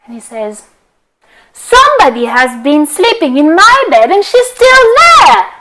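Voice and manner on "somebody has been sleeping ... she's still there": high, loud little bear yell; Baby Bear yelling. Acted. Baby Bear yelling at Goldilocks still in his bed, high and loud. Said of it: "Baby Bear yelled so loudly".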